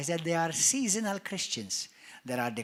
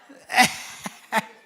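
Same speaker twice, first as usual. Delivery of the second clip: breathy loud laugh; a burst of laughter; real laugh; 3 semitones higher, 6 dB louder than usual. Real, not acted. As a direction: laugh at the joke. Laughing at his own joke.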